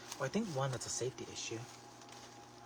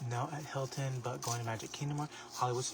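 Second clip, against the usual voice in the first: usual speaking voice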